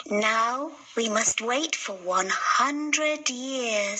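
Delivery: slow, solemn resolve; patient fairy settling in to wait; lightly voiced fairy. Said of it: "said the Poppy Fairy".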